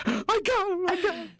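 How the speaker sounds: high, loud victory yell; triumphant shout. Retold or imitated. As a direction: yell it like you just won. Retelling killing the mantis.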